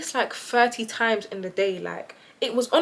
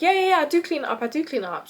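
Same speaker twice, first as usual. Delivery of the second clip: dismissive roommate; 6 semitones higher, faster than usual. Retold or imitated. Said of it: "She's like".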